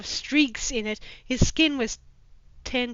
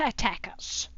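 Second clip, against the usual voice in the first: plain reading voice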